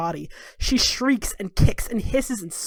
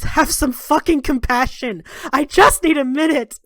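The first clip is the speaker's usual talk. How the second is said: louder, raised and pleading; angry plea; 6 semitones higher, 5 dB louder than usual. Acted. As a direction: snap back at her, begging for time. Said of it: "He says".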